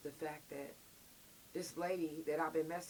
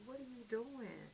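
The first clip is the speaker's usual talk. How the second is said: hushed confrontation; 4 semitones higher, 11 dB quieter than usual. Retold or imitated. Confronting officer alone, hushed.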